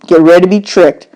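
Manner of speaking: ordinary delivery, nothing marked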